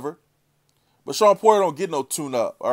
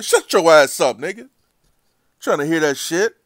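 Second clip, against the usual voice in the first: loud, raised-pitch angry cutoff; exasperated outburst; 4 semitones higher, 8 dB louder than usual. Real, not acted. Vlogger shutting down a boxer's excuse, exasperated outburst. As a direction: shout down a lame excuse.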